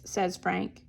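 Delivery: usual speaking voice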